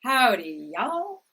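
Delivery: Southern drawl with a rising y'all; friendly Texan Southern accent howdy. Pretend. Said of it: "Which one of those voices".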